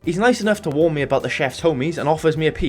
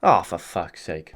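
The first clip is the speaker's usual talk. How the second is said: exasperated groan; 10 semitones lower, slower than usual. Real, not acted. Frustrated groan at game.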